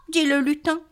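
ordinary delivery, nothing marked

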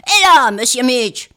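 loud gruff goblin shout. Acted. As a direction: bellow like a grumpy goblin. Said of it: "d'une grosse voix".

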